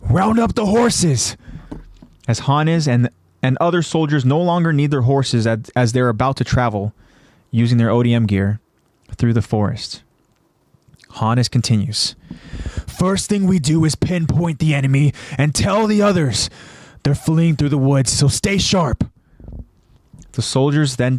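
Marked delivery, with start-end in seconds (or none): 0.0-1.4 higher, slow, drawn-out command; officer giving an order; commander's order. A narrator's reading. Officer ordering one of his soldiers. 12.9-19.0 slightly raised officer voice; brief the troops firmly; mildly acted military orders; commander's briefing. A narrator's reading. Commander briefing his soldiers.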